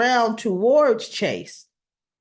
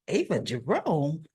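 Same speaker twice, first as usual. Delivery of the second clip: stunned question; 3 semitones lower, 6 dB quieter than usual. Retold or imitated. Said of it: "So Alexis is like".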